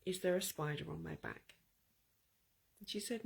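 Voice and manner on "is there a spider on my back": softer, steady, calm question. Retold or imitated. Boy calmly asking his mother, no panic. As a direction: ask it as if nothing were wrong. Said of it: "I very calmly said to my mother".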